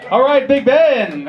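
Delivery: slow, loud call back at the heckler, laughing; loud laughing retort. Real, not acted. Comic to heckling crowd: loud laughing callout. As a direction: bellow at the crowd through a laugh.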